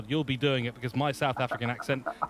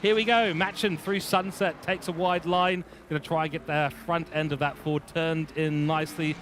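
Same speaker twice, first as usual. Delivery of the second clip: excited play-by-play; 3 semitones higher than usual. Real, not acted. Commentator picking up the next driver's lap, excited. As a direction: kick off the lap call with a burst.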